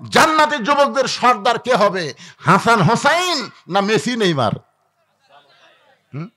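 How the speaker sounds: usual speaking voice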